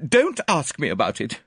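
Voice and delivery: high, loud refusal; agitated woman shutting down questions; distraught Miss Howard. A narrator's reading. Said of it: "continued Miss Howard".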